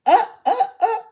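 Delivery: high, loud flustered stammer; mock a startled teen caught off guard; imitating startled stammer. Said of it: "he just like".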